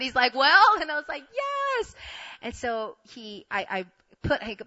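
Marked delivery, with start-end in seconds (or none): 0.3-0.8 loud, high prompting; high, impatient imitation; expectant question. His expectant question after proposing. 1.3-1.9 very high, drawn-out squeal; high-pitched thrilled answer; overjoyed yes. Retold or imitated. Her answer to the proposal.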